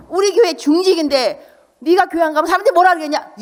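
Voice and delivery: loud, high nagging scold; a hint of a stern church-elder father. Elder father scolding his child for skipping church.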